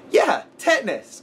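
put-on high, slow, earnest little kid. Retelling how the kid described the movie, in a high slow child voice. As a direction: talk like a small boy explaining a movie.

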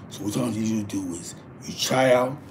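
usual speaking voice

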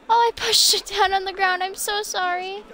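high, loud startled sorry; real embarrassed apology; flustered apology. Real, not acted. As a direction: blurt it out, caught off guard. Knocked down a game NPC.

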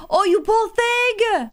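high, slow, drawn-out sympathy; pitying coo. Real, not acted. Player pitying dazed Luna after the fight, pitying coo. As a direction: coo slowly over someone who got hurt.

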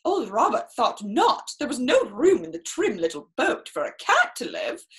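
plain reading voice

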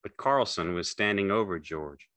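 ordinary delivery, nothing marked

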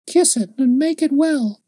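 put-on high child's voice, earnest and serious; grave little girl. A narrator's reading. Little girl asks the man to kiss her sore head, high and grave. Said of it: "she said with perfect gravity".

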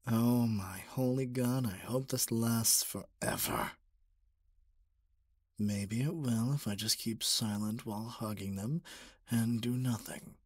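usual speaking voice

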